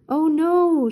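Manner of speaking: high, loud panicked gasp; frightened cry. Acted. Shaggy sees the monster coming back, high and frightened. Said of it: "Shaggy cried".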